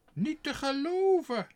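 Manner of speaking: very high, loud outraged cry; a shocked high-pitched exclamation; shrieking lady of the house. A narrator's reading. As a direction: shriek it in disbelief as the stuck-up mistress. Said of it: "ze riep".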